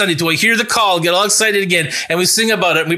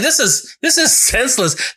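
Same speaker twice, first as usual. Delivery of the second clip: exasperated; 7 semitones higher than usual. Retold or imitated. Voicing an exasperated onlooker.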